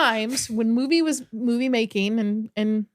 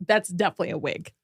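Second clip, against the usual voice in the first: ordinary talk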